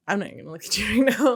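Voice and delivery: laughing through the words; real laughter. Real, not acted. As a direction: try to talk while cracking up. Breaks up laughing mid-story.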